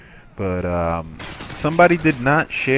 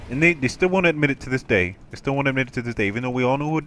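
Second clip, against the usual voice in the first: normal talking voice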